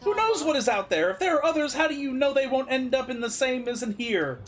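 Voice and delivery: high-pitched, exasperated outburst; exasperated protest. Acted. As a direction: drag out the outburst, then pile on worried questions. Acted quarrel, alarmed at friend leaving.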